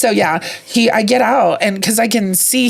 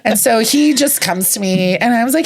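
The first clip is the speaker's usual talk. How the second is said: ordinary talk, nothing marked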